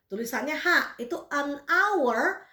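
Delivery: normal talking voice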